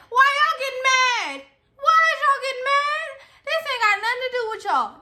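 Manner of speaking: high-pitched mock outrage; exaggerated, high indignation; indignant retort. Retorts to annoyed people in line.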